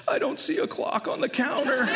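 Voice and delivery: put-on high-pitched whiny toddler voice; little girl. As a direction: imitate a confused, wailing small child. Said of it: "literally she says".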